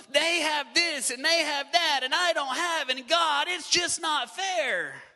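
loud, high, drawn-out whine; jealous complaining. Retold or imitated. Sermon imitating a jealous believer, loud slow whine. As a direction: stretch out "just not fair" slowly.

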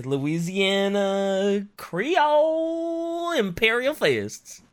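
put-on very drawn-out, swooping Louisiana accent; Louisiana Creole drawl. Host naming the new faction, hammed-up Louisiana drawl. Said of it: "we have Louisiana boy Imperial Fists".

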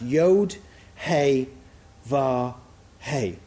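"Yod, He, Vav, He" as separate letter names; "Yod, He, Vav, He" spaced out as Hebrew letters. Shown as a demonstration. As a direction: say "Yod", "He", "Vav", "He" one by one with short pauses. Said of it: "those letters are pronounced".

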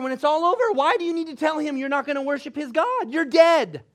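high, loud, incredulous argument; worked-up preaching. Real, not acted. Exasperated preacher mocking a pointless declaration.

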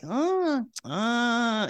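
high, drawn-out "uh" of concern; imitated disapproving "uh". Facialist looking at bad skin, high worried "uh". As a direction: mimic a facialist's high appraising noises. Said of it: "tell by the sound of her voice".

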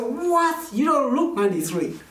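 retold high-pitched disbelief; mock-astonished, pitched well up; astonished reaction. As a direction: act out amazement, drawing out the first word. Acts out his astonishment at her age.

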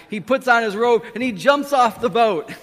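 loud, high, breakneck enthusiasm; excited narration. A narrator's reading. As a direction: build the leap with passion. Narrating passionate Peter's leap.

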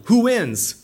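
ordinary delivery

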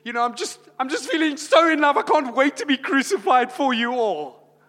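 high, loud, giddy and gushing; mock giddy Jesus. Preacher mocks a giddy Jesus eager for the cross, high and hammed-up. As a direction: ham up a giddy lovestruck voice. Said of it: "Was he like".

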